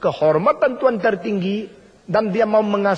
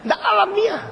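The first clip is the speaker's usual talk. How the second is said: much higher, punchy exclamation; emphatic exclamation; 13 semitones higher than usual. Real, not acted. Preacher to congregation, emphatic high exclamation. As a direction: fling it out in disbelief.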